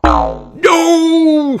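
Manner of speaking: very high, loud cry; thundering no. Acted. Redbeard sees the fish near his boat. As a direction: thunder it in despair. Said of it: "thunders Redbeard".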